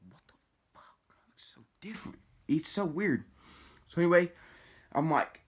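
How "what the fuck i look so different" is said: hushed, voiceless aside acting out disbelief; stunned whisper. Teller replays his shock at his changed looks, stunned whisper.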